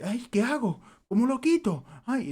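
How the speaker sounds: high, flustered mock mother protesting innocence; nervous mother. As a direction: imitate a panicky mom insisting she touched nothing. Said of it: "ella se pone muy nerviosa".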